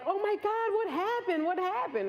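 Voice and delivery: high-pitched imitation of a distraught man; hammed-up tearful alarm; mock crying. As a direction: wail it in mock panic. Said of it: "this guy over there crying".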